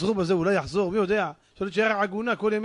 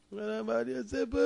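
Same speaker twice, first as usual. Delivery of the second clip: soft, whimpering mock sob; mock crying; 7 dB quieter than usual. Captured man answers the soldiers, mock crying. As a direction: plead weakly, as if crying. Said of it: "זה בוכה".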